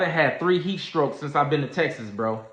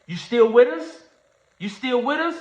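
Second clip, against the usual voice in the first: high calling voice, louder; nurse calling him; 8 semitones higher, 4 dB louder than usual. Retold or imitated. Paramedic lady calling to him, high and raised. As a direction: call out to a fading patient. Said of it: "I heard her saying".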